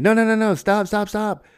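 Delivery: high, loud mock yell; frantic retold shout to stop; yelling soldiers. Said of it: "all these dudes are yelling at him".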